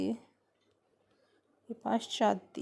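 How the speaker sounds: पाश्चात्य said very slowly and softly; teacher stretching पाश्चात्य for the class; slow spelling-out. Shown as a demonstration. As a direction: drag out पाश्चात्य to show the long पा. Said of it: "तो यहाँ जो पा".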